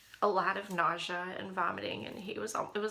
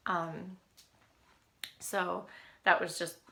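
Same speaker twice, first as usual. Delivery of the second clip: ordinary delivery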